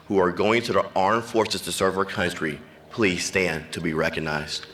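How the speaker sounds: usual speaking voice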